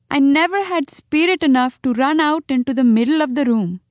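high, tearful complaint; Chuchundar. A narrator's reading. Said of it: "he sobbed".

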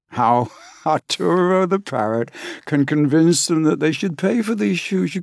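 a touch of the high parrot voice; parrot voice lingering. Acted. Narrator summing up, still half in parrot voice. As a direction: slip back toward the parrot. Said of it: "still in parrot voice".

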